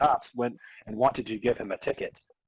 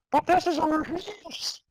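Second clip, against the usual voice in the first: much higher plaintive ghost. Acted. Zombie ghost protests to hero, high and plaintive. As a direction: squeak it like a small sad ghost.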